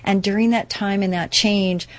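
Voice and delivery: ordinary talk, nothing marked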